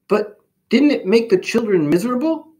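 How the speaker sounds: high, small questioning voice; gentle little germ character; innocent little Pox. A narrator's reading. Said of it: "asked Pox".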